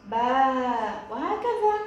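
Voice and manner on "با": "با" with the aa held long and loud; long madd vowel. Shown as a demonstration. Teacher sounding out ba with madd, "با" held long. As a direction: stretch "با" out firmly on the aa. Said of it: "حرف الباء مع مد الألف".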